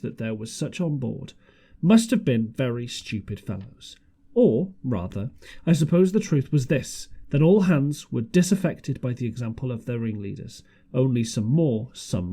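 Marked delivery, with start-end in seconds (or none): none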